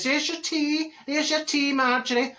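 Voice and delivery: high, singsong neighbour impression; hostess voice. Neighbour bringing a cup of tea, high hostess voice. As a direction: offer it in a high put-on lilt.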